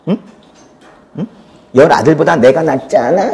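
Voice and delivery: tossed-off boast, half laughing; casual smug husband. Shown as a demonstration. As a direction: brag like a careless husband. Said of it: "뉘앙스가 많이 달라요". (대수롭지 않게 으스대듯)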